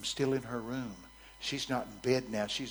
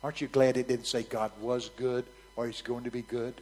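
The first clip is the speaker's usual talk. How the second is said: ordinary delivery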